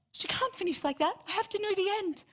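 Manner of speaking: high, gasping mock outrage; dismayed audience member. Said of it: "you all get to sit there going".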